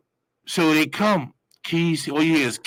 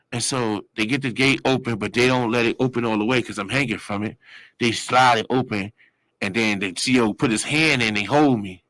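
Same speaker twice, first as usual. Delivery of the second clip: ordinary delivery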